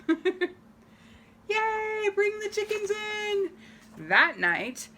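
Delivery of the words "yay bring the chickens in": put-on excited kid. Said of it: "She's excited".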